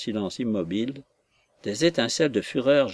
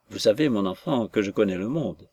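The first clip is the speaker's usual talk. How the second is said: plain reading voice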